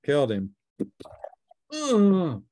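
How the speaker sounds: ordinary talk, nothing marked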